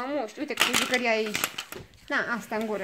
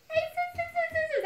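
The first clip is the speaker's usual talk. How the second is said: coaxing a puppy. Real, not acted. Urging the puppy up, high coaxing voice. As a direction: urge the dog up in a squeaky high voice.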